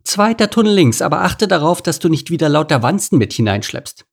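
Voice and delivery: high, brisk commanding meerkat; bossy meerkat chief. A narrator's reading. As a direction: order the workers about. Colony boss directing workers.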